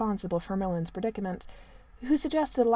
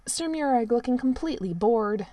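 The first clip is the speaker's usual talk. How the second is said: usual speaking voice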